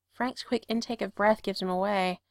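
usual speaking voice

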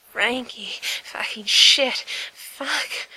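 breathless, stretched-out curse with a strained edge. Acted. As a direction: gasp the curses out as if winded.

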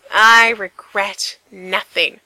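loud, slow, proud proclamation; triumphant declaration. Acted. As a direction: announce it like a victory. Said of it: "Frank declared triumphantly".

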